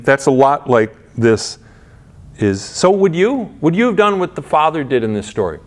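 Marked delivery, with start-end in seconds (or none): none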